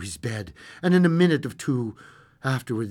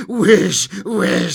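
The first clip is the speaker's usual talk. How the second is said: loud, forceful demand; insistent repeated cry; 5 semitones higher, 11 dB louder than usual. Acted. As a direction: hammer the word out as a desperate order. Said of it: "she repeated".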